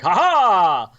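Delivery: high, loud, triumphant ha-ha; mock forties adventure-hero flourish; flamboyant swashbuckler. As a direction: strike a heroic swashbuckler's laughing cry. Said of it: "flamboyancy of sort of the forties swashbucklers".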